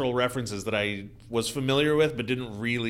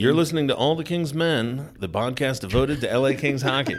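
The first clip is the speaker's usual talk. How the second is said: announcer voice; 3 semitones higher, 5 dB louder than usual. Pretend. Mock podcast intro announcer.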